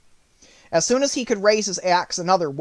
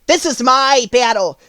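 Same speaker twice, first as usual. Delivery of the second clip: higher, loud, brave scarecrow; bold Scarecrow; 7 semitones higher, 8 dB louder than usual. Acted. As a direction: declare the battle proudly. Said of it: "the Scarecrow said".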